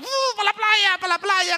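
high, gleeful cry of going to the beach; family off to the beach, high and happy; excited about the beach. Retold or imitated. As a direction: sing out the trip like eager children.

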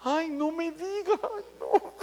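high, slow, pitying "ay, no me diga"; over-the-top sympathy. Retold or imitated. A churchgoer pitying a sad brother, high and slow. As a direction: pile on the pity, slowly, like you might cry too.

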